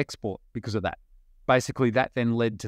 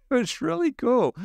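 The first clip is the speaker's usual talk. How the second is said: much higher, laughing through the words; laughing delight; 13 semitones higher than usual. Real, not acted. Founder recalling early sales wins, laughing with delight.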